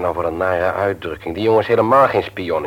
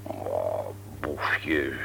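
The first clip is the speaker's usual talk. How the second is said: 1 deflated echo; 9 semitones lower, 11 dB quieter than usual. Acted. Husband echoes word doubtfully.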